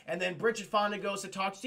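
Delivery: ordinary delivery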